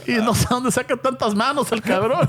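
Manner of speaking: laughing through the words. Real, not acted. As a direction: crack up mid-sentence. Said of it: "laughing while speaking".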